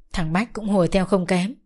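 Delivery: plain reading voice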